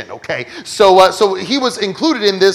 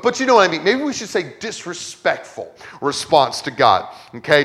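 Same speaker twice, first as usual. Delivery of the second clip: ordinary talk, nothing marked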